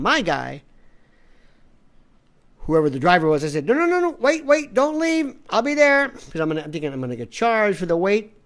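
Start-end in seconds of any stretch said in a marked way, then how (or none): 3.7-6.1 high, loud, urgent plea; urgent pleading call. Retold or imitated. On the phone to his abandoned driver, urgent high plea. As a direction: stop the driver from leaving. Calling driver not to leave.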